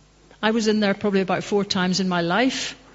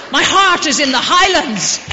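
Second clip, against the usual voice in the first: full-throated shout, "heart" hit hardest; the climax of the poem, yelled high and proud; shouted recitation; 7 semitones higher, 9 dB louder than usual. Retold or imitated. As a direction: bellow the line out to the whole street. Russian stranger recites Burns in street.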